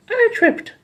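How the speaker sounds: very high, squeaky mock whine; high cartoon villainess complaint; Rita tripping. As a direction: play the villain pouting after a fall. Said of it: "Rita gets mad ... trips up over her feet".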